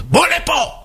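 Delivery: high-pitched angry yell; acted irate high shout; boss summoning. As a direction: holler for an employee to come at once. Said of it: "וקורא למנהל המכירות".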